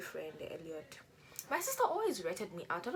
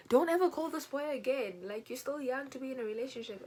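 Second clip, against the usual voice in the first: stern mom; 6 semitones higher, 4 dB louder than usual. Retold or imitated. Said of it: "My mother was like".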